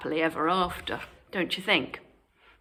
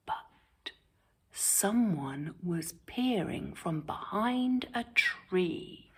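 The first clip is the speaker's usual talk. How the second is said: whisper; 8 dB quieter, slower than usual. A narrator's reading. Suspense turn in fairy tale.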